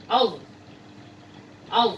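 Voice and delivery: Arabic throat letter; throaty Arabic letter. Shown as a demonstration. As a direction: push "al" from the throat. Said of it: "Ini cara penyebutannya".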